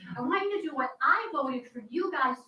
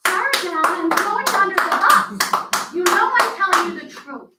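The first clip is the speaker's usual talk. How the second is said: loud, near-shouting plea; shouting at the board, pushing past restraint; heated shouting; 3 semitones higher, 11 dB louder than usual. Real, not acted. Heated plea at town meeting.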